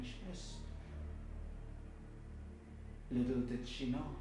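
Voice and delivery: low, very soft, halting narration; subdued, haunted telling; hushed grief. Acted. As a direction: let it sink almost to nothing, with long pauses. Child watching her mother die.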